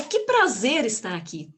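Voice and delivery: mean it, punching "prazer" with delight; enthusiastic. Shown as a demonstration. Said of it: "de acordo com a energia". (com energia e entusiasmo)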